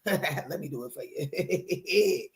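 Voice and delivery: playful laughing self-parody; mock-dramatic laugh. Shown as a demonstration. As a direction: ham up the line while laughing. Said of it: "[name] just get a little dramatic".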